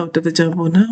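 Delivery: ordinary delivery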